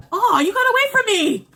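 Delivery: high squeal as food slips; startled, shrill-free high cry; surprised yelp. Real, not acted.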